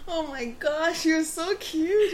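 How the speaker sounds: high, loud, slow gushing laugh; gushing delight. Real, not acted. Telling a friend about a sweet old man, gushing. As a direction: melt over how cute.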